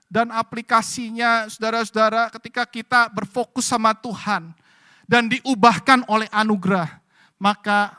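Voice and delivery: loud, high impassioned sermon; rally the congregation to look to God; full-force preaching. Acted. Preaching continues. (khotbah berapi-api dengan suara keras)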